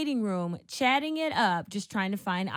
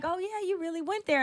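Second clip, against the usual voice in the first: much higher mock-impressed voice; imitating castmate; 8 semitones higher than usual. Castmate after the table read, mock-impressed. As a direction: mimic a castmate's teasing reaction, pitched up.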